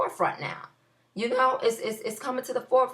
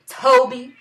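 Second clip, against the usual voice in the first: mock shout; 3 semitones higher, 5 dB louder, slower than usual. Shouting mock baby name.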